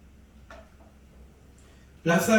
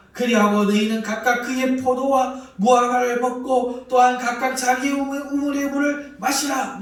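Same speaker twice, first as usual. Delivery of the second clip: higher and louder, luring the crowd; loud coaxing proclamation; 7 semitones higher, 8 dB louder than usual. Acted. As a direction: call the people to surrender, loud and sweet. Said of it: "큰 소리로 말합니다".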